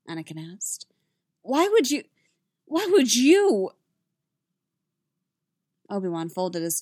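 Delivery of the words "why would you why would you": high, loud, faltering plea; distressed demand. A narrator's reading. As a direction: repeat it in upset disbelief. Anakin demands an explanation.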